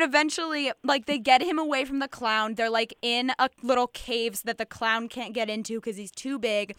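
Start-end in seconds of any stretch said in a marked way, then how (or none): none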